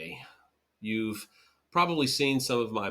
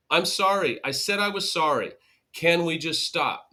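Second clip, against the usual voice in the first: higher, louder, fed-up repeat; exasperated sorry; 6 semitones higher, 3 dB louder than usual. Retold or imitated. Partner demanding the apology end, exasperated and louder. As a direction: push to be done with it. Impatient one-stop apology.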